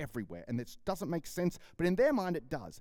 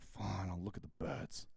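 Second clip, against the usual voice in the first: slow, softer, lower reluctant giving in; begrudging; 7 semitones lower, 8 dB quieter than usual. Retold or imitated. Reluctant follower giving in to Jesus, slow and softer. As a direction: sulk your way into agreeing.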